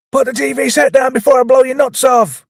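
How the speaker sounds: high, loud hammed-up threat; mock furious shout. Tackleberry in voice training, high hammed-up shout. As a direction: yell it like an over-the-top cop. Acting armed demand in voice training.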